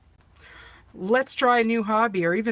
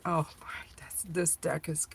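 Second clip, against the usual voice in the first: whispering; 2 semitones lower, 8 dB quieter than usual. Real, not acted. Surprised reaction to new deck.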